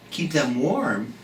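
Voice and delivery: usual speaking voice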